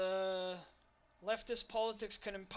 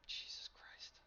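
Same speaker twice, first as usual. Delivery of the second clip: whisper; 9 dB quieter, slower than usual. Real, not acted. Frustrated while the opponent scores.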